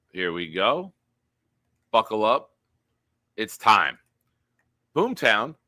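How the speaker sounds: hammed-up announcer build to Boom; announcer intro. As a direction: announce the segment like a ring MC. Announces Boomtown segment again.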